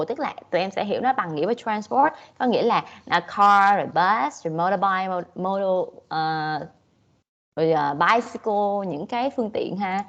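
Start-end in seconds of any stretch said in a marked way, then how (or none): none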